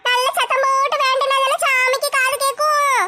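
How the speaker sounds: high-pitched, protesting put-on voice. Arguing that prayer must be noisy, high put-on voice. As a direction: argue back in a high character voice.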